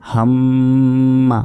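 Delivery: হাম্মা with the ম্ম held long and nasal through the nose; held nasal ghunna. Shown as a demonstration. Quran teacher to students, demonstrating obligatory ghunna with a long nasal hum. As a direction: stretch the doubled ম in হাম্মা into a slow humming nasal hold. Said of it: "এটাকেই বলা হবে ওয়াজিব গুণনা".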